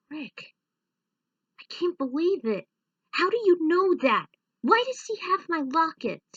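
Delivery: stunned, demanding answers; shocked disbelief. Acted. Clank demanding how Rick knows, shocked and loud.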